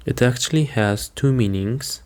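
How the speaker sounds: ordinary talk, nothing marked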